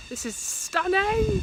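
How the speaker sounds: excited. Real, not acted. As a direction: gasp it out in awe. Sees beautiful view.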